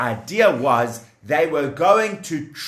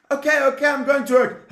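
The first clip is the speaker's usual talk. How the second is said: nervous slave; 10 semitones higher, much faster than usual. Acted. Slave answering the taskmaster.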